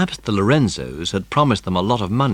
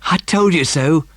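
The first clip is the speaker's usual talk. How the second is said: triumphant; 8 semitones higher, 6 dB louder than usual. A narrator's reading. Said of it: "said Ern triumphantly".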